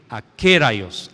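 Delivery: akeraios said slowly, syllable by syllable, a-ke-RAI-os; careful Greek word. Shown as a demonstration. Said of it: "Es que no hablo griego".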